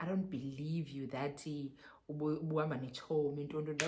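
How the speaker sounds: low, soft imitation of a beaten man; mildly deflated quote; defeated. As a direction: retell his doubt with the energy drained out. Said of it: "he was so defeated".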